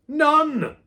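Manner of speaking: very high, loud barked word; teacher barking the answer. A narrator's reading. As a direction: bark it like a slap. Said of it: "barked Pratley".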